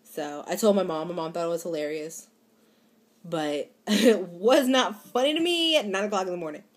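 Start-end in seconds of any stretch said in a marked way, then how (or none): 3.2-6.6 laughing. Real, not acted. Laughs while insisting.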